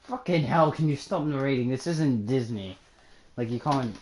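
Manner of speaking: usual speaking voice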